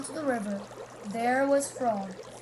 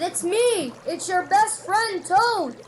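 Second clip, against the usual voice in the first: loud, high calling out; shouting; 6 semitones higher, 6 dB louder, slower than usual. A narrator's reading. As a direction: shout to be heard far off. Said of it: "he shouted".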